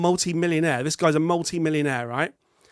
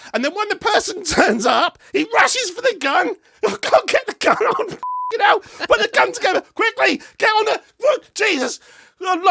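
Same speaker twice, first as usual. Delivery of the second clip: frantic, laughing, high-pitched shouting; panicked rush; 14 semitones higher, 4 dB louder than usual. Acted. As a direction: act out a flustered killer grabbing his gun. Acting out the killer's panic.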